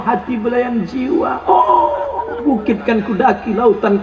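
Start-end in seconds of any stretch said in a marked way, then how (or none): 1.5-2.4 mock swooning oh. Mock romantic sigh.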